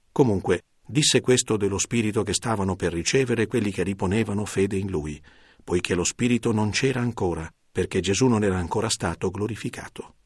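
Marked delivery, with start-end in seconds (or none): none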